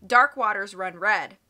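ordinary delivery, nothing marked

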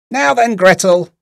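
loud, high witch's call; witch's cry. A narrator's reading. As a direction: cry out sharply to the girl. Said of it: "cried she to the little girl".

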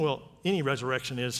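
ordinary delivery

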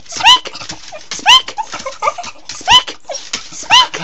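very high, repeated command to a dog; squeaky dog command. Real, not acted.

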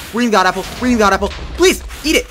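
panicked shouting mid fight; frantic yell. Real, not acted. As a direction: yell as if your life depends on it. Desperate in boss fight.

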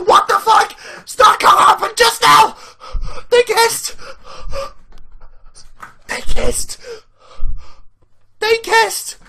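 high, loud yells, near tears with joy; disbelieving screaming. Real, not acted. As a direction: scream in real disbelief, close to crying. Fan still screaming in disbelief.

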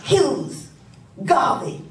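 mock the agent's accusing tone; hammed-up FBI man reading out names. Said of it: "the thing that they show you and says".

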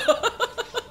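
a burst of high giggling; cracking up at his own prank; high giggle. Real, not acted. After stealing Sofiane's horse, a high giggle.

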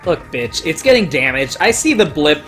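ordinary talk, nothing marked

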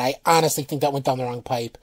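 usual speaking voice

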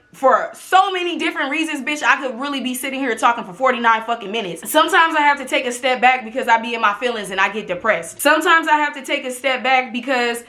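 usual speaking voice

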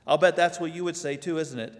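normal talking voice

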